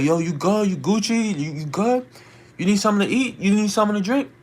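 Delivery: high, calling-out questions; imitated shout, pitched well up; shouted concern, retold. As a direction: call over offering help to a stranger. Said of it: "shouting, I'm like".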